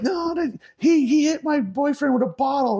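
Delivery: high, whiny imitation of the girl; mocking the angry girl. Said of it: "And she's like".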